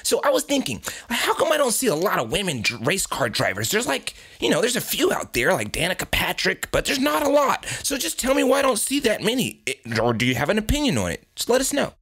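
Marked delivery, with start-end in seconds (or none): none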